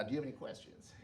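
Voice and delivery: quiet, low-key fatherly question; soft-spoken dad. Retold or imitated. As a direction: ask it gently, barely above a murmur. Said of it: "he said".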